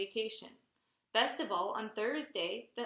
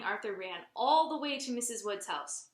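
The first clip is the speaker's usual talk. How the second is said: ordinary delivery, nothing marked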